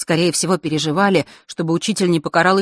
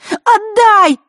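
shrill high yell; вопль; 8 dB louder than usual. Acted. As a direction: scream it, grabbing back. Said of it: "завопила Захара". (пронзительный вопль)

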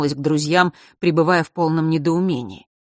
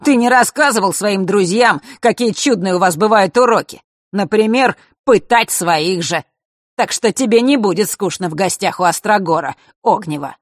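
loud, raised taunting voice; jeering; 4 semitones higher, 6 dB louder than usual. A narrator's reading. Mark baits Fesh about his lessons, loudly jeering. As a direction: needle them loudly in front of everyone.

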